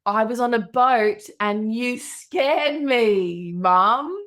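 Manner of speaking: put-on slow, drawn-out child accusation; six-year-old daughter. Daughter accusing mum of scaring her, drawn-out child voice. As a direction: imitate a small kid stretching out each word. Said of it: "So cute how she says".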